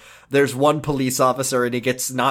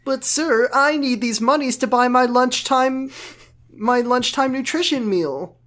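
put-on high-pitched pleading teen; whiny teen voice; 10 semitones higher than usual. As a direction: whine it up high. Teen pleading to impound guy.

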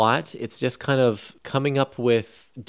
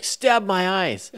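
high, slow mock cry of discovery; excited exclamation; 9 semitones higher, much slower than usual. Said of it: "like he's excited about something he's discovered".

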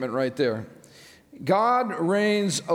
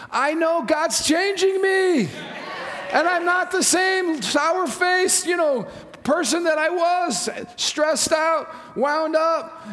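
fervent preaching; 7 semitones higher than usual. Acted. Pastor fervently urging the congregation toward joy. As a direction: preach it out with full conviction.

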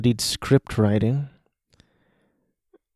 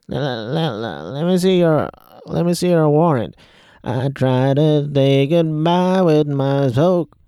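[name]; 4 semitones higher than usual. Retold or imitated. Reviewer mocking [name]'s acting, exaggerated impression. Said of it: "was [name]".

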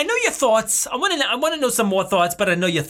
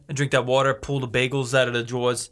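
put-on New Jersey accent; 8 semitones lower, 4 dB quieter than usual. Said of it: "New Jersey accents sound like".

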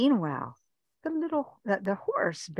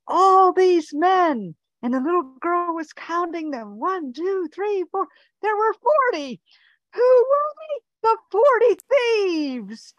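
climbing higher and louder with every number; building excitement; 10 semitones higher, 6 dB louder than usual. A narrator's reading. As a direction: count them off, rising to a thrilling reveal. Narrator counts the thieves, building.

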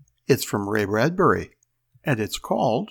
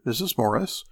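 plain reading voice

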